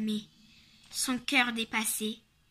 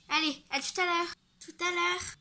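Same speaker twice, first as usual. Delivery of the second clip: put-on child voice; 6 semitones higher than usual. Children saying goodbye.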